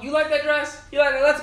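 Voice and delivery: put-on eager boyfriend voice. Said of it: "she says".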